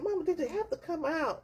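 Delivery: high, soft mock teenage plea; embarrassed protest. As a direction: plead with mama, mildly mortified. Retelling embarrassed protest to mother.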